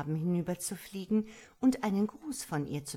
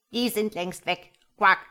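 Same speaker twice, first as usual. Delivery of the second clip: lightly croaky frog voice; a weary old frog; 2 semitones higher, 9 dB louder than usual. A narrator's reading. Old frog answering the starling, lightly croaky. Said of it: "erwiderte der Frosch".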